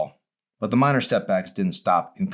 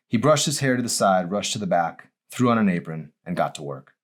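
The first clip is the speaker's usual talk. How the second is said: plain reading voice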